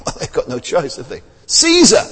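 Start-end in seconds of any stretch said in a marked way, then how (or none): 1.4-2.0 called out high and loud, as the crowd; loud crowd answer. Retold or imitated. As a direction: shout the answer out. Crowd answers Jesus' question.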